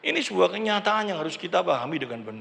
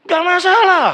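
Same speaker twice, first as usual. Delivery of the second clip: loud; 9 semitones higher, 9 dB louder, slower than usual. Real, not acted. Preacher exclaims loudly.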